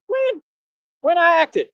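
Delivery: sudden very high, disbelieving squeak; incredulous. Real, not acted. Mocking critics who call him fake, shrill disbelief. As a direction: shoot way up in disbelief at the accusation. Incredulous at being called an actor.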